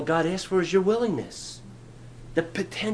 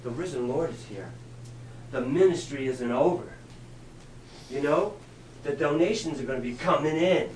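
softer, lower, knowing aside; a hint of a scheming disciple; imagined disciple; 3 semitones lower, 8 dB quieter than usual. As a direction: murmur a self-serving hope. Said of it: "the disciples would say".

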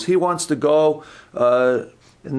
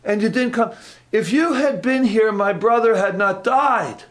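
frustrated Martha; 8 semitones higher than usual. Shown as a demonstration. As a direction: pour out the grievance at Jesus. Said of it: "more of you think she was frustrated".

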